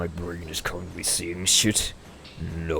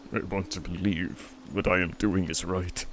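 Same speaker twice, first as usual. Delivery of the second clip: usual speaking voice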